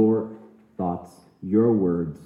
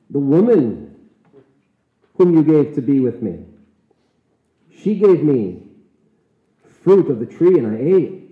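raised, loud protesting voice, defensive; indignant excuse; 3 semitones higher, 7 dB louder than usual. Acted. Adam protesting to God, loud indignant excuse. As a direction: push the blame away hard.

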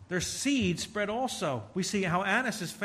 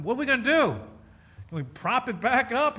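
put-on a child's pleading, higher and louder; boy begging his mom to save the tree, higher and louder; upset little boy; 5 semitones higher, 6 dB louder than usual. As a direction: plead like a four-year-old over his fallen tree. Retells his upset boyhood self.

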